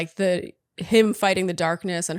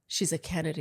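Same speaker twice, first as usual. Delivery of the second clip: very soft, lowered voice; hushed reverence; 5 semitones lower, 10 dB quieter than usual. Acted. Host imagining the aura of the name, hushed reverence. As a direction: drop right down, as if the name says it all.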